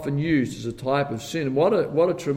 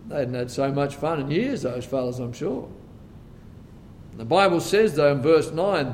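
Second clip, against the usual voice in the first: ordinary talk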